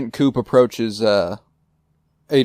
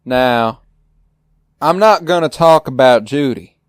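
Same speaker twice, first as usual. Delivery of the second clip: louder, higher firm announcement; Gordon voice; 4 semitones higher, 5 dB louder than usual. Retold or imitated. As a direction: boss refusing to discuss Judy. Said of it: "he wanders in and goes".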